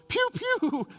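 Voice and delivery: very high, playful pew pew; gunshot noises. Retold or imitated. Saying goodbye to George with his pew pew gunfire, very high. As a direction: fire off two cartoon shots.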